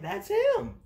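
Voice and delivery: very high, loud excited squeal; hammed-up, high-pitched amazement; excited recognition. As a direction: exclaim it in amazed delight.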